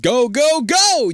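high, loud urgent shout; yell. A narrator's reading. You yell pushing Liz up the chute, urgent yell. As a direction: yell each word, pushing them onward. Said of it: "you yell, pushing Liz from behind".

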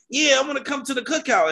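high-pitched mocking imitation of an eager hanger-on; mock eager leftist. Mimicking leftists inviting themselves to the cookout, mock eagerness. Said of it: "Eurocentric leftists always wanna be like".

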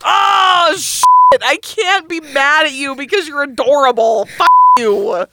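loud, high mock outrage, cursing; mock exasperated yell. Telling her friend she can't be mad, mock yelling loudly. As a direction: yell in fake fury that can't stay angry. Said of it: "And I'm just like".

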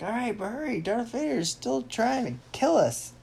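high, urgent hero voice; Captain America. Acted. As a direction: urge a teammate on, pitched high. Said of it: "Captain America's like".

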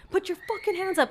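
high-pitched mock cop yell; high, insistent mock order; retold yelling. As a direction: imitate police yelling, without real volume. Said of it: "they're just yelling".

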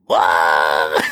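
low startled shout of disbelief; outraged customer yelps at the merchant's price; shocked. Acted.

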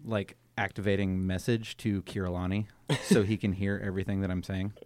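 ordinary delivery

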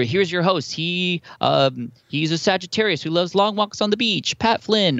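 booming radio announcer intro; high, slow showman announcer read. Retold or imitated. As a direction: introduce the host like a voiceover pro. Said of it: "I have the voiceover guy".

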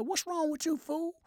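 high, lightly voiced wife; wife's voice. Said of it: "She said".

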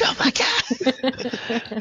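high, stretched-out mock cry; jokey high-pitched wail; mock screaming. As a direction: imitate screaming in labor, very slow and high. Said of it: "screaming the whole time".

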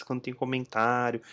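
ordinary talk

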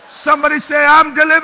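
rally the church to answer; call and response. Real, not acted. Preacher calling congregation to respond.

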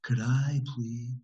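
slow, hushed guru ordering milk; absurdly serene and breathy, word by word; fake spiritual voice. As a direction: imitate a soft, drawn-out meditation-tape voice. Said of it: "in that voice".

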